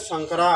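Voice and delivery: সাংকারা as "sang-ka-raa", open a vowels; correct Sanskrit pronunciation. Shown as a demonstration. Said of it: "উচ্চারণ কী হবে?".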